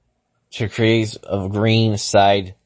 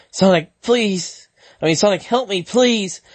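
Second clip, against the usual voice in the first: high, pained pleading; desperate plea; 8 semitones higher than usual. Acted. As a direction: beg for help, pitched high. Sally pleading in agony.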